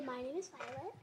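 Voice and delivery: put-on softly feeding the line for the child to repeat; soft prompting voice; quiet prompt. Shown as a demonstration. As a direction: murmur the words as a prompt, very soft.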